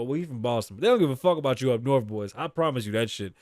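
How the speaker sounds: ordinary talk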